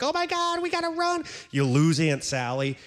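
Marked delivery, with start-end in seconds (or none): 0.0-1.2 panicked. Retold or imitated. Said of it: "and you're like".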